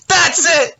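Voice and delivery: shrill high yell of an angry customer; furious shout. Retold or imitated. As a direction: yell it and drive off. Customer snaps and drives off.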